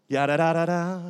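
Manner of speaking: light imitation of a rapper's jingle. Imitating the sound on a cat video, mock rapper. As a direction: toss off the nonsense syllables in another voice. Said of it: "then you hear Snoop".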